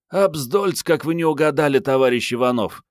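high-pitched woman, mock-formal; Mariette, sardonic. A narrator's reading. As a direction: pitch her up high, teasing her comrade. Mariette mocks her colleague's guess. (высокий женский голос, с издёвкой)

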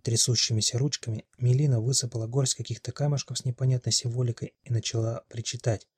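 usual speaking voice

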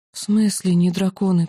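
plain reading voice

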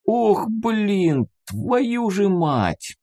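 high, drawn-out dismayed oath; dismayed cursing. A narrator's reading. Рыжий stares at the boy who appeared, cursing in dismay. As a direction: curse slowly in a high voice.

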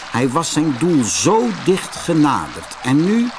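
ordinary delivery

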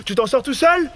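called from outside. Acted. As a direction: shout the question in from outside. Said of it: "crie quelqu'un de dehors".

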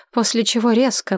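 usual speaking voice

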